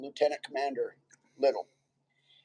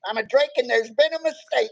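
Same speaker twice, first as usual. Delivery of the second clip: laughing punchline; 6 semitones higher, 3 dB louder than usual. Real, not acted. Delivering joke punchline, cracking up.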